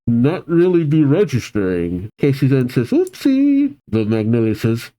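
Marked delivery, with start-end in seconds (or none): 2.9-3.8 high-pitched mock-innocent slip; girlish oopsie. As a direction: squeak it like a girl caught out. Girl owns up to her blunder.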